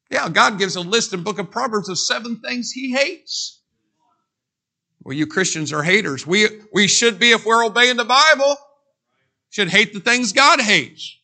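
rising, emphatic sermon voice; forceful preaching. Acted. Preacher answering critics, emphatic and rising. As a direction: drive the point home.

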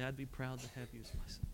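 very soft, near breaking on "son"; tearful, hushed. Retold or imitated. Preacher recalling Brother Jack's blessing, hushed and tearful. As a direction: let it fall to almost nothing. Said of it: "with tears in his eyes".

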